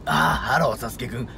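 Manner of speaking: low creaky voice mixed into speech; vocal fry. Shown as a demonstration. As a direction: let the fry rattle through the words. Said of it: "こういう声を普通の声に混ぜると". (エッジボイス混じりの声)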